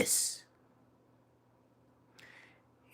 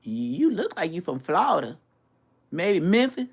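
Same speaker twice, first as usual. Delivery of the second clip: imitating Memphis locals; 6 semitones higher than usual. Mimicking store clerks who asked where he's from. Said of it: "you gotta say it like them".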